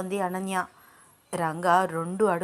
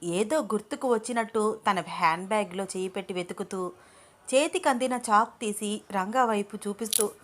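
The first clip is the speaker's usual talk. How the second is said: plain reading voice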